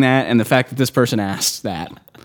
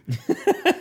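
high squeaky laugh; 4 dB quieter than usual. Pretend. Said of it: "I didn't say it like this".

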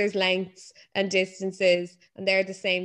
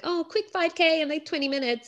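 mock smug runner; 8 semitones higher than usual. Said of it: "They're like".

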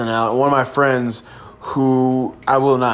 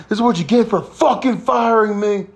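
mock angry yell; 10 semitones higher than usual. Yelling back at the store.

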